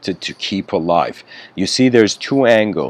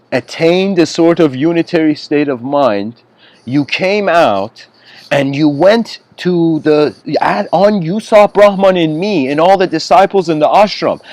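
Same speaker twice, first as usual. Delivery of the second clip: lively guru; 7 semitones higher, 4 dB louder than usual. Retold or imitated. Guru lectures the angry disciple.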